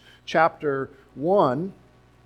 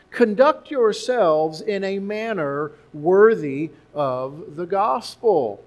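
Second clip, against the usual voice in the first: slow scripture reading; 2 semitones higher, 4 dB louder, slower than usual. Acted. Preacher reads key verse.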